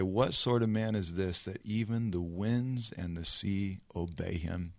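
low, soft wondering; hushed awe. Acted. Disciples watching the sea obey, hushed awe. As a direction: ask it quietly, trailing down to almost nothing. Disciples awed by the calmed storm.

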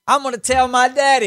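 put-on high tattling kid; whiny kid voice. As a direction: whine like a little brat.

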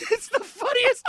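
laughing it out, high-pitched. Real, not acted. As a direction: bursts into laughter while speaking. Laughing about Groucho impression.

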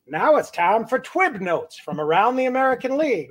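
Mel Allen. Retold or imitated. Host quoting a childhood baseball show, announcer impression. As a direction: imitate a baseball show host introducing a segment. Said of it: "a lot of us know Mel Allen from".

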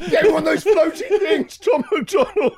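high, laughing mock whine; hammed-up flustered swimmer making excuses; mock whiny self. Host mocking himself losing a swim race, high whine.

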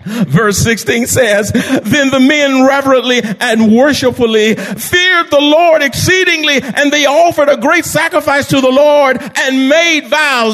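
high, ringing, drawn-out preacher delivery; full-force preaching. Real, not acted. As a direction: preach it big, stretching the words.

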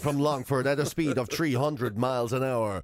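deep, slow announcer voice; fighting game announcer. Retold or imitated. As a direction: sink low and drag it out, ending in a whisper. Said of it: "the full announcer voice".

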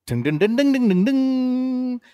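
high drawn-out "ding" build-up; rising dings, last one held long; sung dinging fanfare. Pretend. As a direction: sing dings as a suspenseful reveal jingle. Mock fanfare before the reveal.

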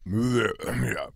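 talking through a mouthful; muffled by a bite of food; mouth full. Real, not acted. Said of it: "I had my mouth full".

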